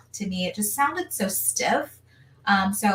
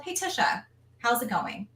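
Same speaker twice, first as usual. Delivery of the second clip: smiling instructor check-in; 6 semitones higher, 4 dB quieter than usual. Retold or imitated. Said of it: "She looked at me, she smiled".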